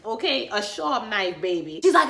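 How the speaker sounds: ordinary delivery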